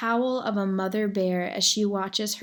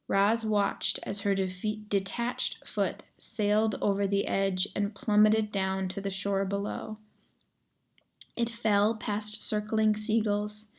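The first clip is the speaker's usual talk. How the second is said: plain reading voice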